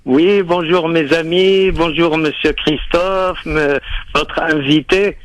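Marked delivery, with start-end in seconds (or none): none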